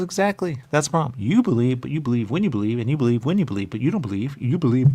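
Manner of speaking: agitated lecture. Retold or imitated. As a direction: hammer the point home. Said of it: "She goes".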